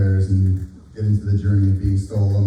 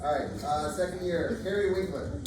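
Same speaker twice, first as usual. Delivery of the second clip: projected, higher announcing voice; teacher voice; 7 semitones higher, 11 dB quieter than usual. Real, not acted. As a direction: call the names out across the room. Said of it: "Can I use my teacher voice?".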